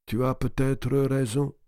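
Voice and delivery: put-on a touch of a weary old man, slow; lightly voiced old uncle. (vieil oncle las, à peine esquissé)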